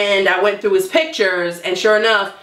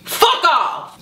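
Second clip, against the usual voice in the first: mock angry snapping; 9 semitones higher, much slower than usual. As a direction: act out someone losing his temper, pitch jumping up. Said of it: "he snapped. Lewis just has a temper".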